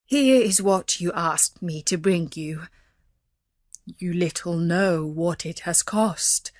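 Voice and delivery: understated sorrow, slightly low; a touch of heavy-hearted reproach; sadly. A narrator's reading. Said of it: "he said sadly".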